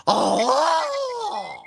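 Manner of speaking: bigfoot grunting. Pretend. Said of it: "Can, can you do a Bigfoot call".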